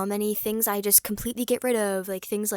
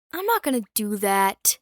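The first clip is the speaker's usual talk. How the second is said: louder, firm refusal; 5 dB louder than usual. Shown as a demonstration. Repeating her decision to viewers, loud and firm. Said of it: "But I'm not gonna do that".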